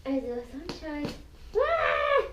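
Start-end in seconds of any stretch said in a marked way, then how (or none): 1.6-2.2 startled yelp. Real, not acted. Dirt flying out of bag.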